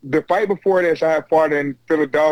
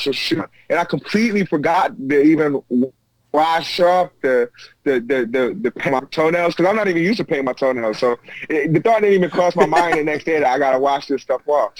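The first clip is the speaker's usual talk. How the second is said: usual speaking voice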